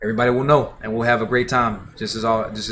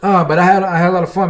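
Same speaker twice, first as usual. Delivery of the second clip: excited outburst; 9 semitones higher, 10 dB louder, faster than usual. Real, not acted. Happy outro after music stream.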